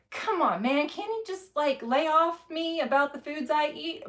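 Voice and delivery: retelling a food argument with her husband, whiny and high; mock pleading. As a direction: beg him to lay off, pitch climbing high.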